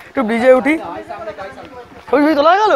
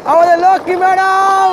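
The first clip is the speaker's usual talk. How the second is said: excited announcing; 6 semitones higher, 3 dB louder than usual. Real, not acted. Announcing arriving friend.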